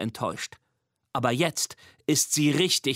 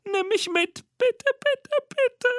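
high squeaky begging, building louder; begging monster; 3 dB louder than usual. A narrator's reading. Mimi begs Nina to take her, rising squeaky pleading. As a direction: plead ever more desperately in a tiny voice.

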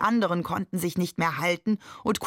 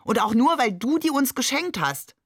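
louder, much higher protest; called out; 7 semitones higher, 4 dB louder than usual. A narrator's reading. Shakir protesting to Babe about the chickens, loud and high. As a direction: push it up in indignant protest. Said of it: "rief Shakir".

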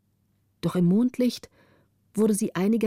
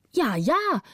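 higher, playful agreeing; teasing yes yes; 7 semitones higher than usual. Acted. The orphan answering the youngest girl, playfully agreeing. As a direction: humour the little one with a grin. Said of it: "sagte die Waise".

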